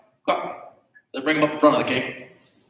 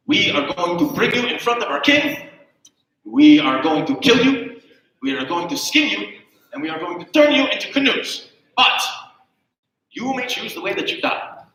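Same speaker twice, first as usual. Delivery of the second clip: menacing king; 6 semitones higher, 3 dB louder than usual. Acted. Native king threatens shipwrecked men.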